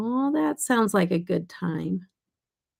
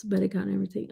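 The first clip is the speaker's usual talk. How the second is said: normal talking voice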